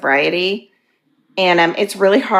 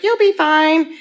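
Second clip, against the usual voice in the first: much higher, breezy mock reassurance; dismissive doctor; 9 semitones higher, slower than usual. Retelling her doctor's careless advice, mockingly breezy. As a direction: mimic a doctor brushing it off. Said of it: "my doctor's like".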